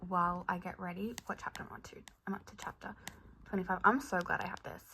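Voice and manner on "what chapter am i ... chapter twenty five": under your breath, barely voiced; muttering to herself. Real, not acted. Reader checking her place to herself, near whisper.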